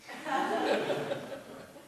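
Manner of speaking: short breathy laugh. Real, not acted. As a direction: laugh at your own line. Author laughs at own joke.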